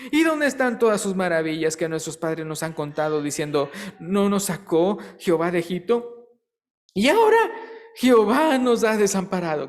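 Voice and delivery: loud, high preaching read of the passage; fervent scripture reading. Acted. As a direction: proclaim the verses to the congregation. Reads the Gideon passage aloud.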